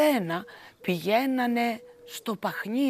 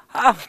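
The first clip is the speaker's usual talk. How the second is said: gleeful ah; 10 dB louder than usual. A narrator's reading. Laughing shepherd.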